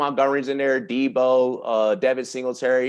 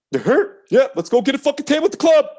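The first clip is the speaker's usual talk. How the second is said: eager; 9 semitones higher, 5 dB louder than usual. Retold or imitated. CEO eagerly agrees to club.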